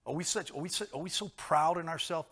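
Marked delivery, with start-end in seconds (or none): none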